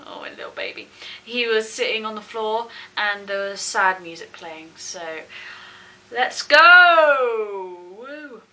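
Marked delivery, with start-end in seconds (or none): 0.1-0.9 soft, much lower whispered coo; tender coo. Real, not acted. Fan cooing over her sad idol on screen, soft whisper. 6.1-8.4 loud eager "let's go"; excited cheer. Real, not acted. Vlogger launching her reaction to viewers, loud cheer.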